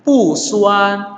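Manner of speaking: Pu falling from high, suan held level; original fourth tone. Shown as a demonstration. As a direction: drop Pu sharply before suan. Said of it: "nada aslinya".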